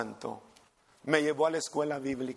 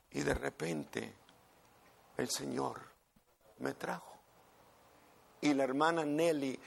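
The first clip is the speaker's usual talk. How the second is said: usual speaking voice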